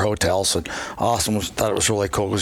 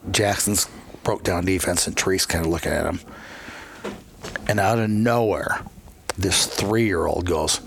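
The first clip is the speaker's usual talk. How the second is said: ordinary talk, nothing marked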